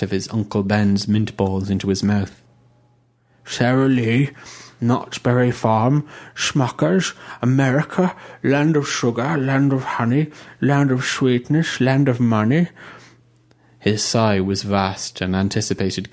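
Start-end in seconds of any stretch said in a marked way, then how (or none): 3.4-12.7 higher, swooning over sweets and money; dreamy greedy bishop. A narrator's reading. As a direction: savour each land of like a hymn. Bishop rhapsodises on America.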